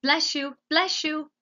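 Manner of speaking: high, insistent repeated call; high shouted blessings; shouting. A narrator's reading. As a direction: fire it out twice, high-pitched. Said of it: "shouted Meg".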